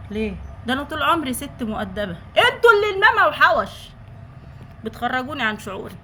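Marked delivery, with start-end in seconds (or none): 2.4-3.7 higher and louder, fed up; indignant outburst. Real, not acted. Blaming the commenters for making her lose her temper, indignant. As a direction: blurt it out in offended anger.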